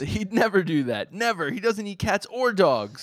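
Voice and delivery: high, loud, slow indignant denial; a flustered boy flatly denying a rumour; Denji, indignant. Acted. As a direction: hit NEVER hard and keep the pitch up. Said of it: "but I'll be Denji".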